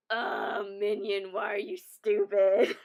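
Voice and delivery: loud, slow mocking jab; imitating a little brother teasing. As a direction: drag it out like a big brother scolding.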